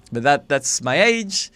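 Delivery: ordinary talk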